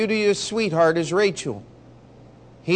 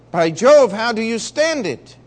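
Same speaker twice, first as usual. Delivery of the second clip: loud "By Jove", then rising higher; loud astonished outburst; 5 semitones higher, 6 dB louder than usual. Acted. Officer shocked that Marion's men go unpaid, loud outburst. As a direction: blurt it out in disbelief.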